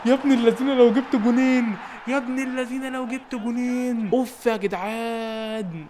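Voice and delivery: stretched, excited match-call voice; hyped commentator. Acted. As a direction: belt it like a TV commentator hailing a goal. Repeats line as hyped commentator.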